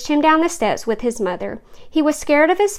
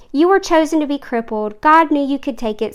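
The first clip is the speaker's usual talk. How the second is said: ordinary delivery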